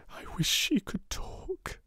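put-on girl whispering to her doll; said to herself. A narrator's reading. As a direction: murmur a private wish. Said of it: "she said to herself".

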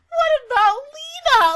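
high loud distressed what about Lena; loud whining plea. Real, not acted. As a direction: wail the question at the show. Upset the character is missing.

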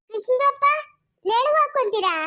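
high-pitched naive question; calling out. Acted. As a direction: call over and ask innocently, voice pitched high. Neighbour calls out a naive question. (ಎತ್ತರದ ದನಿಯ ಮುಗ್ಧ ಪ್ರಶ್ನೆ)

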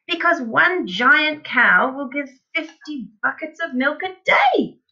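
high, emphatic, climbing to the end; excited cry. A narrator's reading. Father waving his arms at his son, crying out. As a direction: proclaim it like a marvel. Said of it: "cried Mister Cranky".

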